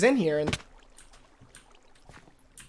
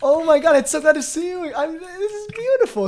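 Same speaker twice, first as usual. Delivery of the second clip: joyful reunion; 6 semitones higher than usual. Acted. Roleplays reunion with boyfriend.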